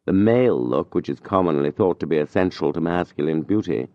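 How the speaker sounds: ordinary delivery, nothing marked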